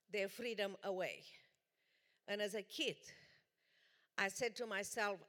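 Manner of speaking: ordinary talk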